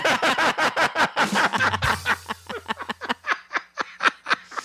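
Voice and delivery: long high laugh. Real, not acted. Laughs at own joke.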